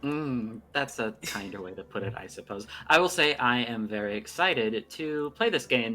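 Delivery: ordinary talk, nothing marked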